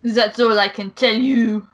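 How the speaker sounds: put-on French accent. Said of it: "best French accent".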